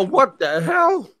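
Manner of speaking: slow, high-pitched mock disbelief; incredulous. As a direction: draw it out, baffled by the demand. Retold shock at repeated demand.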